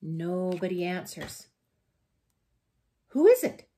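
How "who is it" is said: high, loud storybook question; bear asking. A narrator's reading. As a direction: call the question out loudly. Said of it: "asks Bear".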